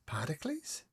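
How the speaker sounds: breathy whisper, dazed; whispering his name at the wall; stunned hush. Acted. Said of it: "she stares at the wall and she says".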